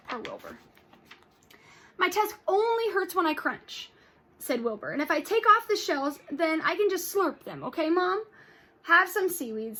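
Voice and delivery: lively read-aloud with character voices; storybook read-aloud for kids; reading to children. Acted. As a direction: read it to the class with expression. Teacher reads story aloud to class.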